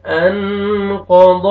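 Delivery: انقض with a thick, heavy ق; heavy qaf. Shown as a demonstration. Teacher demonstrates heavy letters in انقض. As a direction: read انقض with full heavy qaf and ضاد. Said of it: "قاف کو موٹا کر کے پڑھیں گے".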